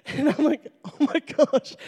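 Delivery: high-pitched, laughing through the words. Real, not acted. Telling how they started weeping at verse one, laughing in disbelief. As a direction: giggle helplessly into the line.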